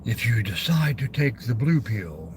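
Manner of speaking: a hint of a low Matrix narrator; Kmart announcer voice. Streamer finishing the blue pill line in a mild deep voice. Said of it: "I always wanted to do the Kmart voice".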